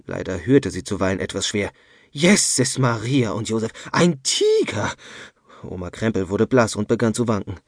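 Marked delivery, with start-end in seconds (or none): 2.1-4.9 slow, climbing to a high frightened wail; old woman crying out in fright; panicked cry. A narrator's reading. Grandma panics over the tiger.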